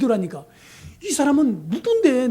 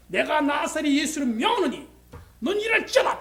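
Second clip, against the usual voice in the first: commanding healing; 4 semitones higher than usual. Retold or imitated. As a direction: proclaim it like an authoritative prophet. Commanding healing in Jesus' name.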